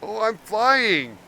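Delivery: excited slow cry. Real, not acted. Player floating in low gravity.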